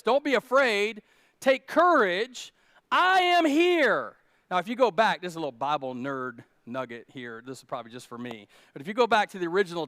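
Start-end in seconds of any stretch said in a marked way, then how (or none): 0.0-4.2 slow, loud and high, calling out comfort; reassuring Jesus. Acted. Jesus calling to the frightened disciples, slow reassurance. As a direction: call out over the storm, calm but strong. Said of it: "And he says this".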